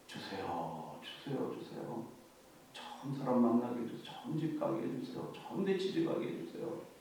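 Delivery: very soft, low, whiny pleading; mock a greedy churchgoer begging God; hushed imitation of selfish prayer.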